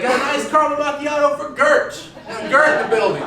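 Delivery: high-pitched order call across the shop; barista calling out. Retold or imitated. Barista searching, calls order out again.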